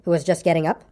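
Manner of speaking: ordinary delivery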